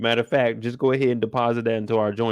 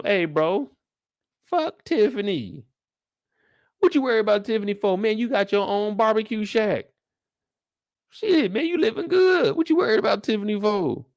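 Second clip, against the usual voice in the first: hyped homeboy voice; 9 semitones higher than usual. Retold or imitated. Friend telling the sad husband to forget Tiffany: high, pumped-up homeboy voice. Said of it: "I'm telling him".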